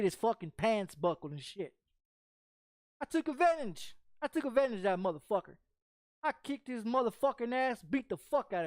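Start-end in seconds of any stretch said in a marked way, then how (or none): none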